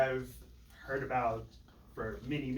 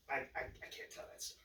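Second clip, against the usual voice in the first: sighing, then a hushed stammer; tongue-tied; 10 dB quieter, faster than usual. Pretend. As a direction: act out words failing you, softly. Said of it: "to the verbalization, it's like".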